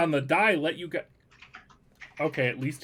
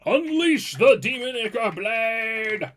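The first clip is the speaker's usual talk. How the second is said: high, drawn-out heroic call; hammed-up battle cry; 7 semitones higher, 5 dB louder, slower than usual. Game master calls out a player's special attack, hammed-up battle cry. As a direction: belt out the attack name theatrically.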